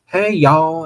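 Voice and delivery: loud, lightly played Southern drawl; Southern accent drawl. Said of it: "in her southern accent drawl".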